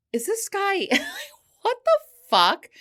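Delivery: high, loud, laughing "what the fuck?"; laughing disbelief. Retold or imitated. Laughing in disbelief at her date's line, high and loud. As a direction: crack up in disbelief.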